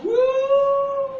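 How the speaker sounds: high whooshing wind woo; high loud howling wind sound. Acted. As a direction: howl like a wild snowy wind. Wind blowing in story.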